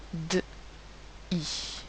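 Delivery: "D, I" as isolated sounds: d, i; bare d sound, pause, then "I". Shown as a demonstration. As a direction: sound out "D, I" softly with a gap between. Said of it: "Deux sons".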